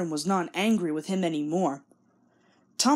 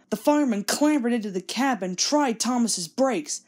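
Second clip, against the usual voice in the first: plain reading voice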